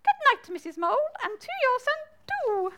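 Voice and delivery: high, quick squeaky bat. Acted. Bat bids the moles good night, high quick squeak. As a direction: chirp a brisk squeaky goodbye. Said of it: "said the bat".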